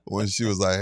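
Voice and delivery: ordinary talk, nothing marked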